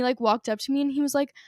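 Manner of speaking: ordinary talk, nothing marked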